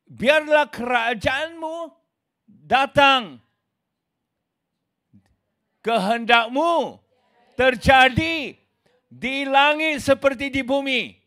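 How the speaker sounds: slow, solemn prayer voice, each phrase set apart. Shown as a demonstration. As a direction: pray it slowly and reverently, as it is meant. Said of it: "langsung dikatakan".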